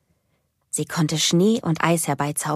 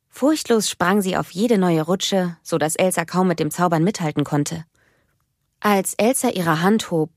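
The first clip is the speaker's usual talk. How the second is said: ordinary delivery, nothing marked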